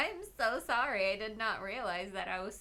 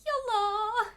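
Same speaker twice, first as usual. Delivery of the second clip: cutesy hello; 11 semitones higher, 6 dB louder than usual. Real, not acted. Cutesy greeting to chat.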